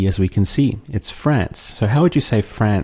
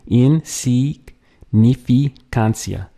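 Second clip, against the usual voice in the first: Insignificancia drawn out slowly, syllable by syllable; slow syllable demo; 3 semitones higher, much slower than usual. Shown as a demonstration. Teacher slowly breaking down Insignificancia, syllable by syllable. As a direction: stretch out every syllable of Insignificancia. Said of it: "a lot of syllables to get through".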